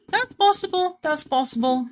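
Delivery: squeaky high falsetto, teasing; high playful voice. Pretend.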